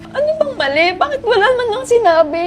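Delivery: pleading why it went wrong; distressed. Real, not acted. Bride telling interviewer of betrayal, distressed and pleading. Jilted bride recounts heartbreak.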